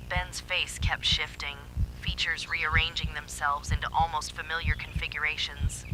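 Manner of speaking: ordinary delivery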